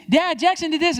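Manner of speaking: put-on high, loud tattling child; a kid running to dad to tattle, high and whiny. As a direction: whine it like a kid telling on his brother.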